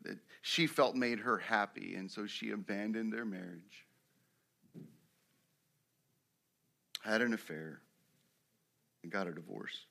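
low, hushed sermon illustration; somber storytelling. A narrator's reading. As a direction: tell it softly and gravely, with long pauses. Solemn story of a man's ruin.

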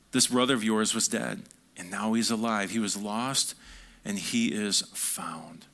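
hushed, reverent father; solemn tenderness. Acted. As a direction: let the father's joy settle into quiet awe. Father's words, solemn climax.

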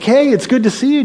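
very high, bubbly hello; enthusiastic greeting. Retold or imitated. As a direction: burst in thrilled to see a friend. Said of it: "very excited and comes up enthusiastically".

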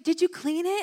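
put-on high-pitched little girl asking eagerly; high, excited child voice; imitating excited child. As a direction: act out a small kid's thrilled question. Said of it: "she's so excited".

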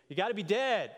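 put-on a boy's high, loud blurted answer; retold shout. A boy in the back shouting his answer, loud. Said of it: "a boy in the back screamed".

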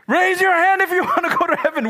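fast, loud high preacher call with a laugh; preacher's loud call. Retold or imitated. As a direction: shout it to the crowd, quick. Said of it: "We would all be like".

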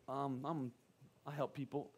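low, very soft, hesitant answer; sheepish mumble. Retold or imitated. Pastor to neighbor asking his job, sheepishly. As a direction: mumble it as if embarrassed to admit it.

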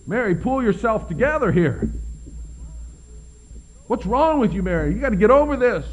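mock-impatient friend telling a griever to snap out of it; impatient scolding. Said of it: "Would you say".

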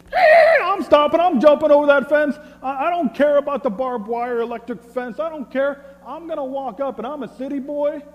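very high, comic outburst, loud at first; wildly excited. Acted. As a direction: burst out hammed-up, as if nothing can stop you. Acts out thrill at purple cow.

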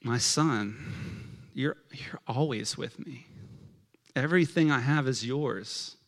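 soft, gentle, unhurried reassurance; acted-out fatherly calm and sincerity; tender father. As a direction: answer anger quietly, with long pauses. Voices the father answering his son.

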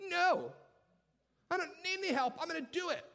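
put-on high, loud insistent kid voice; a little kid refusing help; stubborn child. Child refusing parent's help, high insistent voice.

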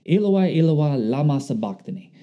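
quoting Jesus' Aramaic words from the cross, Eloi rising then falling; Aramaic cry. Retold or imitated. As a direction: call out Eloi in Aramaic, then let it fall. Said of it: "he says it in, uh, Aramaic".

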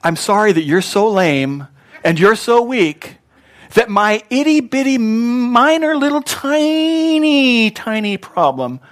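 hammed-up, drawn-out belittling, climbing high; mocking condescension. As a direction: stretch it into a squeaky-high mock apology.

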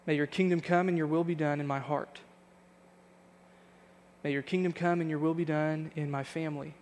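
prayer. Acted. Pastor praying for church at service close, earnest prayer voice. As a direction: pray it over the congregation, measured and sincere.

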